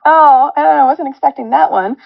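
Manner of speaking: loud, high, tearful laughing-crying; bursting through tears, caught off guard; teary outburst. Real, not acted. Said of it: "Jamie has caused [name] to cry".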